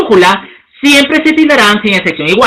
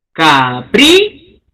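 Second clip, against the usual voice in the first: capricho drawn out slowly, lower, leaning on PRI; slow stress demo; 4 semitones lower, much slower than usual. Shown as a demonstration. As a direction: stretch it to show the stress.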